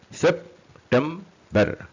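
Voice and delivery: very slow, SEP - TEM - BER with gaps; syllable by syllable. Shown as a demonstration. Teacher counts three syllables for learners, syllable by syllable. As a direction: break September into three separate beats.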